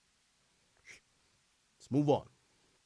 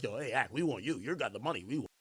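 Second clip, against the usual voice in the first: mock caller voice; 7 semitones lower, 13 dB quieter, faster than usual. Mocking callers wanting him.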